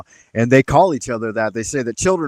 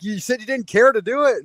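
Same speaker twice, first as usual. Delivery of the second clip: defensive self-quote; 9 semitones higher than usual. Retold or imitated. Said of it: "I'm like".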